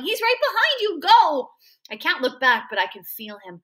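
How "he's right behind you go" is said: high-pitched urgent warning, rising to a shout; yelling. A narrator's reading. Freak warning his friend of the pursuer, high yell. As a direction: cry out a frantic warning. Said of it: "Freak is yelling".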